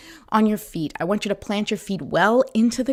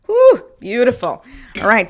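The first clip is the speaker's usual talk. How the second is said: normal talking voice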